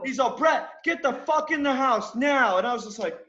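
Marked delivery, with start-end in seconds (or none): 0.3-2.6 imitated angry order, pitched high; retold high-pitched telling-off; boss cursing. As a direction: act out the boss ordering someone off. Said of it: "cursed in Michael's direction".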